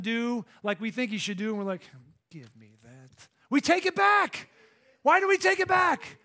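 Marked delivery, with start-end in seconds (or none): 2.3-3.3 very low, hushed mock grab; grabbing it back. Preacher acting out snatching worries back from God, hushed. 3.5-6.2 loud, high exasperated cry; exasperated preaching. Real, not acted. Preacher challenging the room on taking worries back, loud and exasperated.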